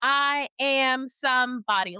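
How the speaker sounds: slow, emphatic mock command; imitating movie cops. Mimicking cops with boys on the ground, slow mock command. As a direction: spell it out slowly like a preachy cop. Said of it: "and they're like".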